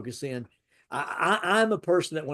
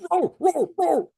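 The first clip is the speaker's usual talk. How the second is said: high loud mock bark, repeated; dog barking imitation; 8 dB louder, slower than usual. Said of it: "barking like Dolly did".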